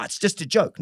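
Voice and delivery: ordinary talk, nothing marked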